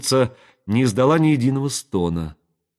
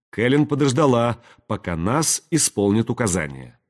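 usual speaking voice

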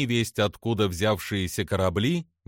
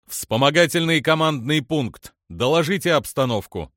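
raised-voice military order; commanding; 5 semitones higher, 5 dB louder than usual. A narrator's reading. Officer demands a status report, commanding. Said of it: "приказал Самакра".

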